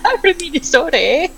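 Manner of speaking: put-on playful high-pitched Minnesota accent; joking Minnesota lilt with "eh". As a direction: put on a light mock Minnesotan. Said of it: "I keep slipping into accents".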